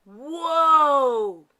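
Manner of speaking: loud startled whoa; startled cry. Acted. Santa cries out as the sleigh jolts, loud startled whoa. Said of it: "cried Santa".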